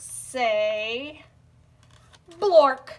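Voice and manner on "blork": blurted out loud and goofy; loud silly word. Acted. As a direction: bark the nonsense word out. Forced to read nonsense word.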